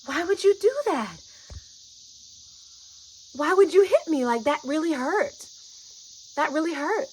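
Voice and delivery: startled hurt cry of why; hurt protest. Retold or imitated. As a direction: demand why she hit you. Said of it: "reacted the way I would with my brother".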